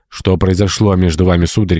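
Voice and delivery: plain reading voice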